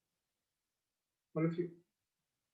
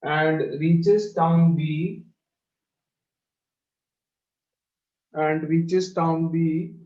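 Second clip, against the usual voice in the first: very slow, each word spaced; slow dictation; 5 dB louder, much slower than usual. Shown as a demonstration. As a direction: dictate slowly for copying. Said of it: "teacher dictating to class".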